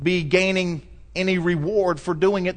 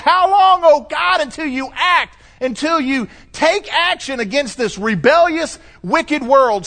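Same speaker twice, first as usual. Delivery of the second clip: high, loud pleading cry of the martyrs; 10 semitones higher, 5 dB louder than usual. Retold or imitated. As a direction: call out to God in anguish, much higher. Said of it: "they're crying out".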